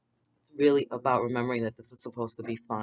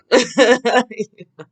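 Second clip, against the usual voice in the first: loud burst of laughter; 11 semitones higher, 12 dB louder than usual. Real, not acted. Laughing at friends' stories.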